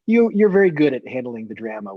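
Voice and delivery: usual speaking voice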